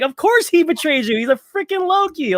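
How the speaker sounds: high-pitched incredulous retort; squeaky, exasperated comeback; mock exasperation. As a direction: exclaim it as if it were obvious. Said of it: "And it's like".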